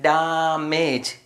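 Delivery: drawn out syllable by syllable; slow sounding out. Shown as a demonstration. Teacher sounds out damage very slowly for young learners. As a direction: stretch damage slowly so children hear each sound. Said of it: "D A M A G E".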